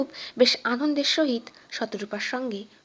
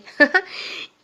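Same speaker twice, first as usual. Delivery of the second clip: laugh; 4 semitones higher, 5 dB louder than usual. Acted. Wife laughs at husband.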